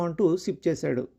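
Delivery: usual speaking voice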